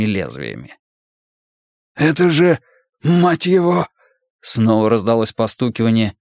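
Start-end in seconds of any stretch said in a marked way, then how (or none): 1.9-3.9 higher-pitched narrated outburst of shock; shocked cursing. A narrator's reading. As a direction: curse in shock, pitch lifted. Character swears at grisly discovery.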